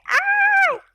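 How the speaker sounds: high loud yelp of pain; hit hard crying out. Real, not acted. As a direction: yelp as if hurt. Falls on face in game. (grito de dor agudo)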